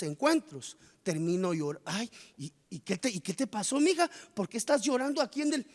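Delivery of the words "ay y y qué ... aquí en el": concerned relative. Acted. Relative finds believer crying.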